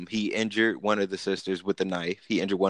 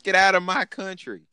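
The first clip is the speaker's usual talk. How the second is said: high, hammed-up bigot voice; 10 semitones higher, 4 dB louder than usual. Mocking the people who shout at immigrants, hammed-up and high. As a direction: parody the angry bigot, pitched up.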